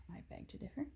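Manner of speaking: mock defiant whisper. Parent whispering disagreement to daughter, playful whisper. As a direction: whisper it back at the book.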